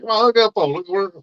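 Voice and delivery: high, excited, rushed fatherly calling; dad calling his hooked daughter over; flustered dad. Retold or imitated. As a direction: hurry her over, half panicked. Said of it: "I was like".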